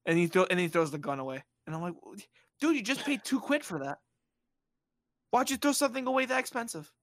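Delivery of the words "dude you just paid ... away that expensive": high-pitched disbelief, speeding up; incredulous protest. Retold or imitated. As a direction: question a friend's senseless waste. Teller's incredulous reaction, acted.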